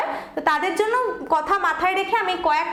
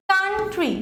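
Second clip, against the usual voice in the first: Country with weight on কান, tree falling; stress on first syllable; slower than usual. Shown as a demonstration. Teacher repeating the example for students, country stressed on কান. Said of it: "কান্টে আমার জোরটা বেশি পড়ছে".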